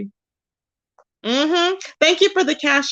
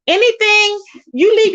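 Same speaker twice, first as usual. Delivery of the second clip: emphatic burst; 9 semitones higher, 4 dB louder than usual. Real, not acted. Ranting about karma.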